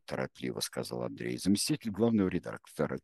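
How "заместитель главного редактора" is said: ordinary delivery, nothing marked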